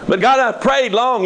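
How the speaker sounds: high, whiny plea to God; pleading believer. Acted. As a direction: beg God like a frustrated believer.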